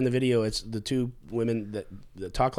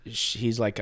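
normal talking voice

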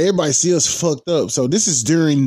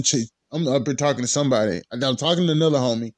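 ordinary talk, nothing marked

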